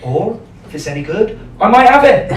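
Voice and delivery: imitated Bristolian tannoy announcement; Bristol announcer accent. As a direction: read a station notice in a broad Bristol accent. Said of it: "the best tunnel announcement I've ever heard. You can only hear this in Bristol".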